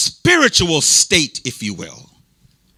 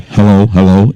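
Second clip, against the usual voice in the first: calling hello into the microphone, louder; testing if the mic is on, raised voice; loud mic check; 13 semitones lower, 7 dB louder than usual. Real, not acted.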